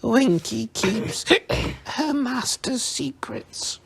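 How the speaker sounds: high, slow and stubborn, tailing into a whisper; mutinous. A narrator's reading. Tipsy elf refusing Harry's questions, mutinous and slow. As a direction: clam up defiantly. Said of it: "she said mutinously".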